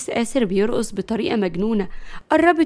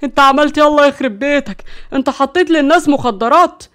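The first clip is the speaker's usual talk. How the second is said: loud, raised incredulous "what?!"; shocked outburst; 3 semitones higher, 7 dB louder than usual. A narrator's reading. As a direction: burst out in stunned disbelief. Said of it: "برق مروان بصدمة". (بصدمة وصوت عالي)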